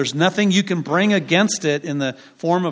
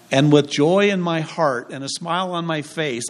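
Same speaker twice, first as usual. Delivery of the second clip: ordinary talk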